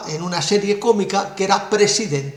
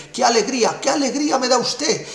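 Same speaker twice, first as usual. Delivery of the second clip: much higher, gushing joy; thrilled as if dancing on the desk; overjoyed; 6 semitones higher than usual. Retold or imitated. President thanking Merkel for the news, overjoyed and higher. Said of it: "acaba bailando encima de la mesa".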